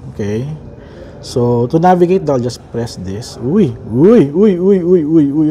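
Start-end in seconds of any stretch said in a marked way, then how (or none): 3.4-5.5 rapid-fire surprised exclamations; startled excitement. Real, not acted. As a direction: blurt it repeatedly, loud bursts of real surprise. Startled as watch display changes. (sunod-sunod na gulat na bulalas)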